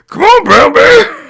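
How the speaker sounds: high, slow yelled cheer; cheering shout. Acted. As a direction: howl it from the crowd. Cheering on a fighter.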